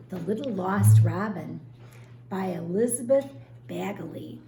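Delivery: usual speaking voice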